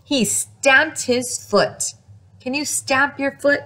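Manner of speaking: usual speaking voice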